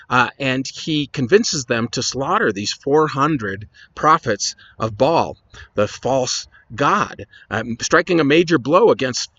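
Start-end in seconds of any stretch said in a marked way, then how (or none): none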